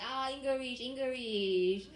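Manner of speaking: put-on loud, high, gloating Chinese-accented English; mock Chinese accent. Comic taunts the French guy, loud triumphant mock Chinese accent.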